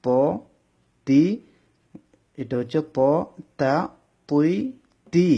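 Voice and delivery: "প" and "তুই" heavy, "তা" and "তি" light; letter drill. Shown as a demonstration. Teacher drilling heavy and light letters for students. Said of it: "ব্যবধান বুঝতে হবে".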